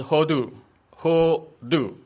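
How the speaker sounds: Hodu spelled out slowly, ho-du; slow Hebrew word. Shown as a demonstration. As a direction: teach Hodu syllable by syllable. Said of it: "赞美就是".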